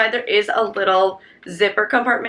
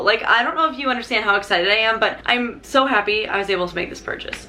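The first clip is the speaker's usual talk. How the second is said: ordinary talk, nothing marked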